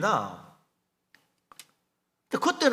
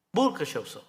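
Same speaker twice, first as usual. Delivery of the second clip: usual speaking voice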